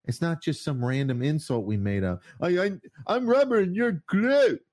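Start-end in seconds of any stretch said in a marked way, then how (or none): 2.4-4.6 put-on high, loud childish sing-song; childish taunt. Mocking the left as a whining child, high and loud. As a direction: taunt it like a schoolkid. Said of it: "The fucking Pee Wee".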